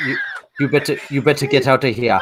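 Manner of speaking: quick, put-on Welsh voice; bad Welsh accent. Pub brawler ordering a stranger out, bad Welsh accent. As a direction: botch a Welsh warning. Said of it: "Where the fuck was he from?".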